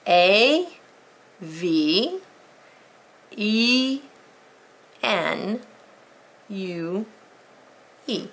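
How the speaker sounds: letter by letter with long gaps; spelled out. Shown as a demonstration. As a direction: spell it slowly for a class to follow.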